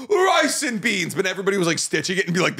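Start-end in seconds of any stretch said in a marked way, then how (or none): 0.1-1.1 high, drawn-out mock Jamaican accent. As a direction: imitate an exaggerated Jamaican accent, stretching it out. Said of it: "doing a racist Jamaican".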